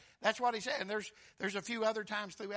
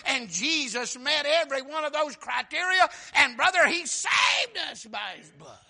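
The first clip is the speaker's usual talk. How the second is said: louder and higher, shouting at the end; preaching climax; 5 semitones higher, 10 dB louder than usual. Acted. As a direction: build the list to a shouted peak.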